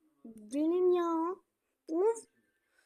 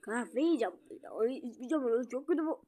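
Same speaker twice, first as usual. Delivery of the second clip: normal talking voice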